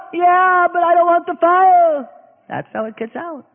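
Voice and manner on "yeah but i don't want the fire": high, whiny pleading voice; high whining plea to the Lord; impatient believer. Acted. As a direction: nag like a child in the fire.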